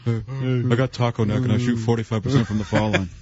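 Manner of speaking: Charles Barkley impression. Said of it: "his Barkley just now".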